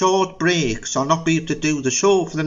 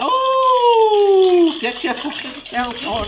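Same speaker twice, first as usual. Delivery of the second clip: high loud angry shriek; shrieking, raging host; furious camp shriek; 14 semitones higher, 3 dB louder than usual. Acted. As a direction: screech at the missing guest. Angry outburst at late guest.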